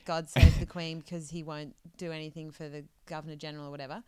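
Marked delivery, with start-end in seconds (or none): 0.1-1.0 loud declaration; declaiming. Retold or imitated. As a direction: declare it from the steps. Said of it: "he's saying".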